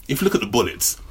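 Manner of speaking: normal talking voice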